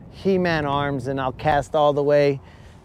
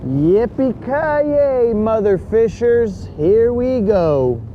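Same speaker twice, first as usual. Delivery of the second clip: drawn-out, higher movie hero yell; action hero catchphrase; 5 semitones higher, much slower than usual. Retold or imitated. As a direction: belt it slowly like a movie hero. Die Hard catchphrase again.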